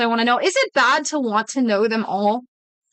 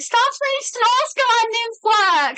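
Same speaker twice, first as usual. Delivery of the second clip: squeaky high whine at her partner; high mock whine to the partner; high exasperated plea; 10 semitones higher than usual. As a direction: pitch it way up, begging him to stop. Quoting herself to partner.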